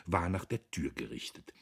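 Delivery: plain reading voice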